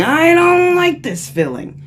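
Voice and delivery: high, slow whiny voice; mock a person fussing over feelings; whiny complainer. Imitating someone who rejects their anger, whiny and high. Voicing someone judging emotion.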